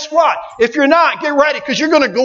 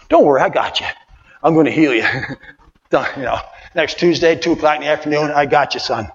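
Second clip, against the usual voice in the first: God's tender reassurance; 9 semitones lower, faster than usual. Acted. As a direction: comfort him gently, low. God voiced, low and tender.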